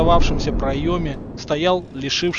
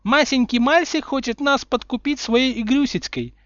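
high, lisping mock-toddler voice; simpering, childish mockery; show-off baby talk; 11 semitones higher, 4 dB louder than usual. A narrator's reading. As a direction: taunt in squeaky baby talk. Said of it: "выпендрилась Белатрикс".

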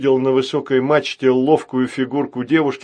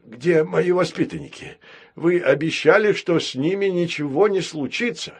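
worried cry; 6 semitones higher than usual. A narrator's reading. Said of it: "взволнованно кричал Баюн".

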